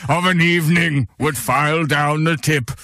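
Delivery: put-on old statesman voice; Winston Churchill. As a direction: do a mock-grand statesman's growling oration. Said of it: "your Winston Churchill voice".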